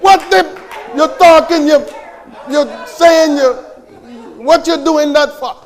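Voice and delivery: preacher pretending to scold someone, loud; mock outrage. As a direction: play shocked at the speaking in tongues. Mock indignant outburst.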